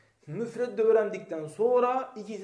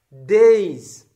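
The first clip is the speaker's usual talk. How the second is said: Days called out loud as Zeyd reversed; word read backwards, loud; 14 dB louder than usual. Shown as a demonstration. Teacher reading Zeyd backwards to the class, loudly. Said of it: "tam tersini oku".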